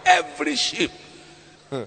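ordinary delivery

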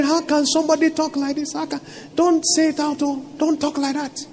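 high, hushed mock dismay; imitated disbelieving onlookers. Onlookers wondering at the bold declaration, imitated hushed disbelief. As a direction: imitate people cautioning in a small, high voice.

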